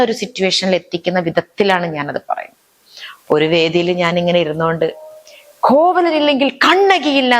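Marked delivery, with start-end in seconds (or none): 5.6-7.4 much higher, slow, grieving wail; emotional stage line. Retold or imitated. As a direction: Kannaki mourning Kovalan, slow and high. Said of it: "വികാരഭരിതയായിട്ട് ഞാൻ പറയണം".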